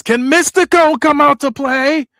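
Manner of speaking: high, loud, slow mocking call; taunting singsong. Connor close to the camera calling out Mystico, slow high loud taunt. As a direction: tease him out like a bully in the playground.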